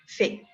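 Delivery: "fait" said fe, final t silent; French fait. Shown as a demonstration. Teacher modelling "fait" as fe. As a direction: say "fait" as plain fe. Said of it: "¿Cómo pronuncio?".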